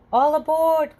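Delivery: loud, high conductor's call. A narrator's reading. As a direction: call everyone aboard the train. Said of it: "calls the conductor".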